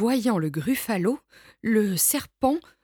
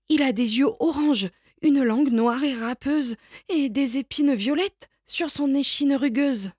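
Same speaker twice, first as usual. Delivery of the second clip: higher, mounting narrator voice; suspenseful storyteller, raised voice; dramatic story narration; 5 semitones higher, 4 dB louder than usual. A narrator's reading. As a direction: build dread as you describe the monster. Narrator describes the monster.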